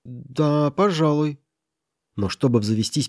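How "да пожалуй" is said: hesitant, slightly higher-pitched yes; mildly reluctant, drawn-out assent; hesitant agreement. A narrator's reading. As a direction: concede it after a moment's doubt. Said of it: "с минуту поколебавшись, подтвердил".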